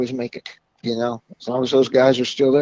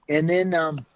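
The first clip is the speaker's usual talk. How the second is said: normal talking voice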